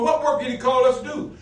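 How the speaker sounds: usual speaking voice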